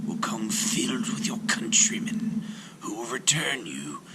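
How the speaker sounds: gentle whispered serpent; comforting serpent whisper. A narrator's reading. Serpent consoles the weeping sailor in a whisper. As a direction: soothe him in a snake's whisper. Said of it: "said the serpent".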